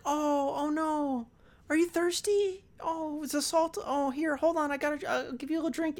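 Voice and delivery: high, cooing mock sympathy; fake concern. Exorcist tricking the girl into drinking, fake concern. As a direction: play sweetly helpful in a high put-on voice.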